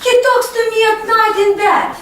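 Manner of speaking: animated telling. Shown as a demonstration. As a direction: tell it like an eager kid. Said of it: "Repeat after me".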